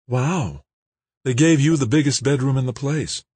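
put-on light, high girlish voice; young woman voice. A narrator's reading. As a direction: voice Kimberly bright and young. Kimberly voiced by narrator.

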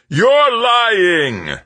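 high furious shout, very loud. A narrator's reading. Mayfair shouting down Tavalera's story. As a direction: accuse him at the top of the voice. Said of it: "Mayfair shouted".

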